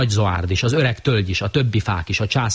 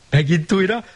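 sing-song; 11 semitones higher than usual. A narrator's reading. Varied refrain, higher.

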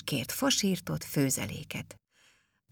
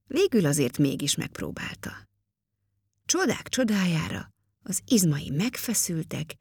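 usual speaking voice

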